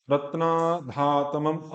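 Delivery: usual speaking voice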